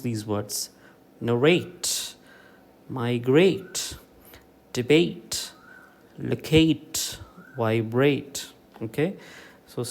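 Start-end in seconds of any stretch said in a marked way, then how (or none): 1.3-8.4 narrate, migrate, debate, locate, vibrate, each stressed on its ATE ending; narrate, migrate, debate, locate, vibrate with strong second syllables. Shown as a demonstration. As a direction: punch RATE, GRATE, BATE, CATE, BRATE in narrate, migrate, debate, locate, vibrate. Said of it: "give primary stress to the".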